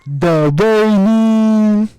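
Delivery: high, very loud, stretched-out call; announcer impression. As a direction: wail it out like a grand ring introduction. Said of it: "Why does he say it like that?".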